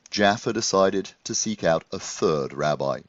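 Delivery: usual speaking voice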